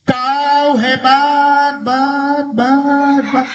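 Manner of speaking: high, long call with bouncing last syllable; imitated echo. Mountain returns the father's praise, imitated echo. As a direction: stretch it out, then repeat the tail. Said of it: "Karena jawab".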